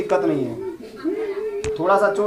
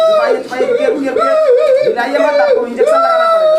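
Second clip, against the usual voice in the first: urgent shout. Acted. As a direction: shout at the patient to hold still. Doctor shouting at injured patient.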